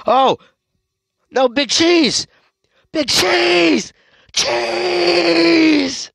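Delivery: high, loud anguished shout; desperate yelling. Real, not acted. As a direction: scream his name as he falls. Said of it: "sorry for, um, yelling".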